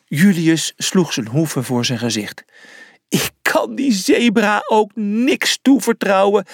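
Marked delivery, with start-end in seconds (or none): 3.1-6.5 high, wailing despair; sobbing. A narrator's reading. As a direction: lament it tearfully, pitched up. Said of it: "snikte hij".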